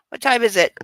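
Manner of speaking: usual speaking voice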